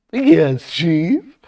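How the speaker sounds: high, loud underling's reply; put-on high, eager voice. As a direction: pipe up loudly to the boss. Voices Olsen answering his chief.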